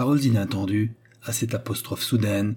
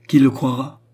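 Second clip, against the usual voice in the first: superb disdain; 4 semitones higher, 7 dB louder than usual. A narrator's reading. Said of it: "avec un superbe dédain".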